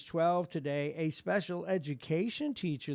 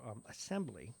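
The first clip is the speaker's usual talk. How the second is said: ordinary talk, nothing marked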